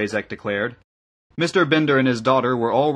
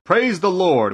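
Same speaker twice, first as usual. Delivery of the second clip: high, louder devout call; cry of praise; 8 semitones higher, 5 dB louder, slower than usual. A narrator's reading. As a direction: call it out from the doorway. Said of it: "Just then a cry of".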